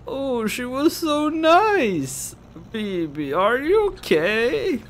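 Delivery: put-on very high, slow drunken wail; drunk sympathy. Drunk girl consoling bereaved friend, high slurred wail. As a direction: overblown gushing, vowels dragged out.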